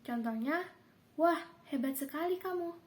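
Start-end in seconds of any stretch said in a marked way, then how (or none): 1.2-2.8 "Wah, hebat sekali kamu" lifted high with mild praise; a light, higher demo of an exclamation; high exclamation tune. Shown as a demonstration. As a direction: exclaim it, pitched up, as a teacher's example. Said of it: "yang pelafalannya dengan intonasi tinggi".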